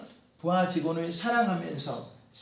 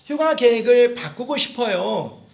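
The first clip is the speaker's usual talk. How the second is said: repeat after me; 3 semitones higher, 7 dB louder than usual. Shown as a demonstration. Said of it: "다 같이 한번".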